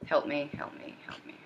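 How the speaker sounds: pleading whisper, no voice; a desperate whispered help me; whispered plea. Acted. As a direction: breathe the words out as a plea.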